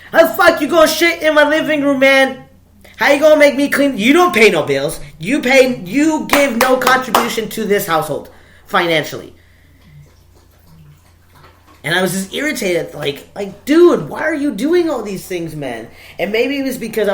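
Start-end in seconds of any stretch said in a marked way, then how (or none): 0.1-9.3 loud, high-pitched telling-off; angry scolding. Real, not acted. Yelling at the dog over the living room mess, angry scolding. 13.7-15.9 loud, high exasperated rebuke; exasperated scolding. Real, not acted. Exasperated at his dog, loud high scolding.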